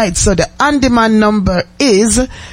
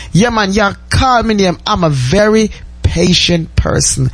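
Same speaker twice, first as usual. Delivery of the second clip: ordinary talk, nothing marked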